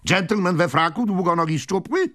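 high, loud, urgent query; excited old gentleman; impatient questioning. A narrator's reading. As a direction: fire off the description impatiently.

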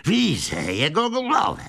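high, slow, excited cry; hot-tempered old man calling; choleric shout. A narrator's reading. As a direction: shout out the sighting, stretching the words. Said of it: "zawołał choleryczny starzec".

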